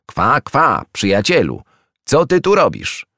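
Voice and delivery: high, bright quacking duck voice greeting a friend; duck calling out. A narrator's reading. A wild duck calls to her old friend, high and delighted. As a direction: call out like a happy wild duck.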